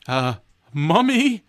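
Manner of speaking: high, loud, drawn-out question; startled, rising-voiced yelp; alarmed cry. A narrator's reading. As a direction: blurt it in dismay. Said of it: "you cry".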